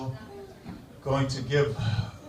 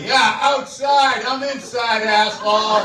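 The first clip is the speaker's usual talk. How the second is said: rude local retort; 5 semitones higher than usual. Retold or imitated. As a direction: sneer it at the cheerful guy. Grumpy local snaps back.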